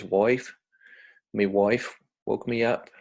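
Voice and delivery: Aussie wife, like 'woife'; demonstrated Australian accent; broad Australian accent. Shown as a demonstration. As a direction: imitate broad Australian vowels. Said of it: "We would say wife".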